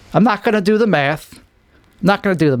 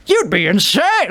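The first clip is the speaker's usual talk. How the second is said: mock pitch; 7 semitones higher than usual. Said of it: "when you're telling somebody".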